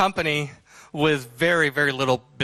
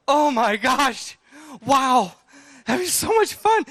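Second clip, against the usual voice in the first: much higher, slow, breathless delight; giddy excitement; 9 semitones higher, slower than usual. Retold or imitated. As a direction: sound overjoyed. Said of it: "and we're like".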